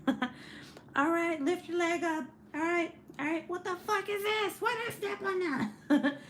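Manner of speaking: high-pitched strained workout host with a laugh creeping in; mock workout instructor. Imagining herself cussing through a workout video, high mock-strained voice.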